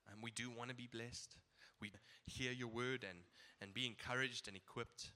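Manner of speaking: unhurried, earnest prayer voice. Acted. Pastor prays aloud for the morning service, sincere and unhurried. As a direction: pray sincerely over the church.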